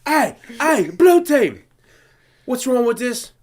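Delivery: high, loud mock challenge; hammed-up kitchen confrontation; imitated angry chef. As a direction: call the team out sharply.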